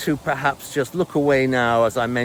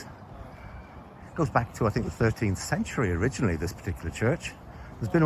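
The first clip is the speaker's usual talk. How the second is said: hushed, creeping tour-guide whisper; stealthy whisper; 2 semitones lower, 8 dB quieter than usual. Pretend. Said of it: "stealthily creeping up on Saint Peter's".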